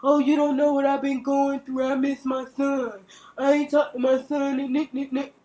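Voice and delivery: a hint of a whiny excuse-maker; mild mock whine of a deadbeat dad. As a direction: lightly mimic his self-pitying excuses. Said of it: "don't say".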